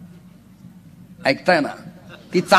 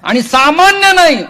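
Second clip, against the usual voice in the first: preaching at full force; 11 semitones higher, 9 dB louder than usual. Acted. Kirtankar preaching to the gathered devotees, high and loud. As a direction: lift the line out to the whole crowd.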